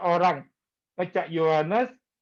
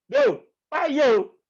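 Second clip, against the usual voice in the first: louder, higher, slow mock gossip voice; mocking imitation; 7 semitones higher, 5 dB louder, slower than usual. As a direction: ham up the eager gossiper. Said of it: "bisa gini". (menirukan orang bergosip dengan nada mengejek)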